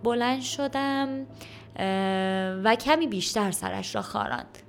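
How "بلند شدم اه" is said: slow, stretched-out fillers between words; halting, unsure recollection; hesitant schoolboy. A narrator's reading. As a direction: grope slowly for what comes next. Boy struggles to recall his morning.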